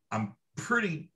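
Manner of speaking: ordinary talk, nothing marked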